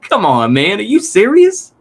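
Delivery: high, cracked are you serious; real disbelief, voice jumping up. Real, not acted. Streamer reacting to the guest's plan, high incredulous disbelief.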